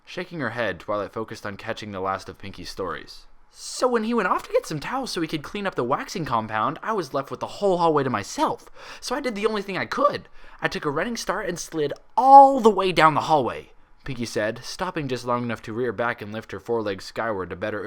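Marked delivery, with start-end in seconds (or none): 3.5-13.7 high, bouncy and loud, rattling on; bubbly Pinkie excitement. A narrator's reading. Pinkie boasting to friends about sliding down the hallway, bubbly. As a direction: gush like a kid who just had the best idea.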